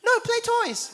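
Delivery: put-on very high, babyish two-word whine; toddler voice. Dad showing how his toddler really says it, high babyish whine. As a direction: squeak it like a two-year-old refusing. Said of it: "He doesn't say it like that".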